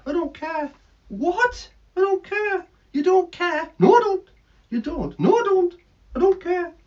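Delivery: high, loud cheeky bird voice; bratty bird puppet refusing; defiant puppet bird. Acted. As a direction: squawk it back defiantly.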